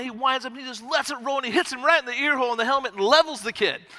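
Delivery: normal talking voice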